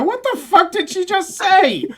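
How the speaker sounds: high, loud disbelieving what-the; baffled outburst. Retold or imitated. Dock worker and cadet reacting to her, loud high disbelief. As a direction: squawk it out in total disbelief. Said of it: "as if to go".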